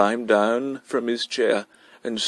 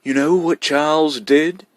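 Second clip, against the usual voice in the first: demanding; 6 semitones higher, 4 dB louder than usual. A narrator's reading. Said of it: "he demanded".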